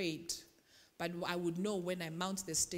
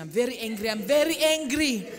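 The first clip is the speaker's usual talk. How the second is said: "I'm very angry" shouted higher and louder, laughing; mock angry while dancing; 3 semitones higher, 12 dB louder than usual. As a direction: belt out the anger with a laugh. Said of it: "And you are dancing".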